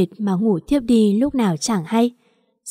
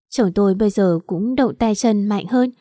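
plain reading voice

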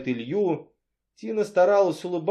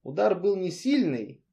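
usual speaking voice

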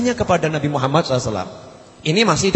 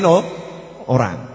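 ordinary delivery

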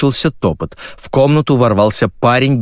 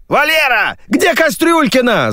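very high-pitched shout from afar; yelling. A narrator's reading. Crew member hunts for the missing guest, yelling. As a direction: holler down the corridor. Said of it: "заорали из коридора".

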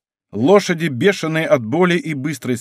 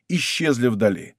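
usual speaking voice

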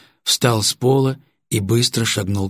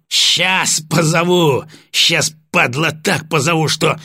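menacing mock promise; 7 semitones higher, 3 dB louder, slower than usual. Sergeant menaces, slow and loud.

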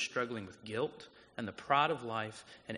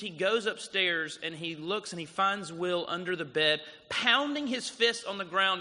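ordinary talk, nothing marked

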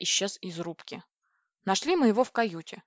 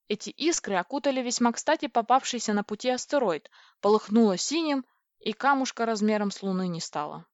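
plain reading voice

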